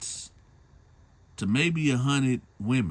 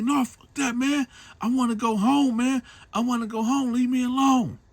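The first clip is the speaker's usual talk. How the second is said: much higher, louder, desperate begging; desperate pleading; 5 dB louder than usual. Retold or imitated. Captive man begs the big guy to let him go home, high and desperate.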